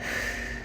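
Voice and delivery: a long, soft breath out; a quiet unvoiced exhale; soft sigh. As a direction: let a slow sigh go to model releasing. Breathing cue in meditation.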